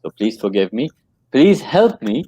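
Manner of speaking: ordinary talk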